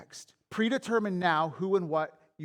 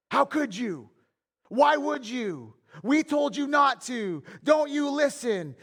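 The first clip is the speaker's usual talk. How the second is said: put-on higher, louder and slowing, scolding the kids; angry parent scolding; 7 semitones higher, 4 dB louder, slower than usual. Preacher voicing a parent yelling at kids, heated scolding. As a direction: let the emotion burst out at the children.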